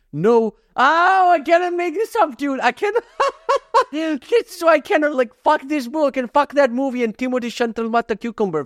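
high, cracking up; laughing through the words. Real, not acted. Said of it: "try not to laugh challenge".

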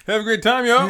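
high, loud mock American twang; imitating a cheesy American sign-off; American accent. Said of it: "Is it American?".

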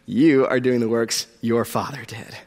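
low, hushed repeat of an accusation; soft, low, pointed delivery; quiet accusation. Acted. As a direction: drop right down and let it sink in.

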